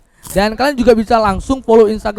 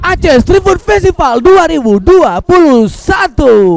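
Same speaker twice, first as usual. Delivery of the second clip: slow, loud festival name call; announcer voice; 6 semitones higher, 3 dB louder, slower than usual. Acted. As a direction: boom out the event name. Co-host completes opener. (suara pembawa acara lambat dan keras)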